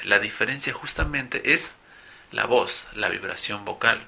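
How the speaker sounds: ordinary talk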